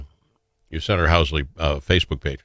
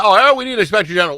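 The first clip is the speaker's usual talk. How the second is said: quick, higher mocking singsong; mock whiny demand; 7 semitones higher, 3 dB louder, faster than usual. Senator mocking colleagues who call for an inspector general, quick mocking voice. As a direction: parrot them with a sneer, fast.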